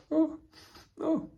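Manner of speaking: high, breathy mock sobs; a demo of sobbing. Shown as a demonstration. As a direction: pretend to sob for the class. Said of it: "Sobs is also when you're crying".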